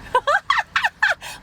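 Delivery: very high-pitched cackle; mocking laugh. Real, not acted. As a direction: burst into a high, derisive cackle.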